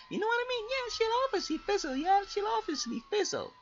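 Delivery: lightly voiced high silly self-talk; squeaky mock voice. Gamer mocking his own overconfident plan in a high voice. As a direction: squeak it out like a cartoon.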